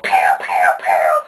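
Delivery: deep loud pew pew zaps; alien laser noises. Pretend. Retelling alien attack with low loud laser zaps. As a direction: blast out low laser sounds. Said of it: "these aliens come like".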